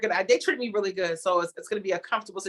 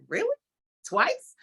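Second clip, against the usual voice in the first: retold disbelief; 8 semitones higher than usual. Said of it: "'cause I was like".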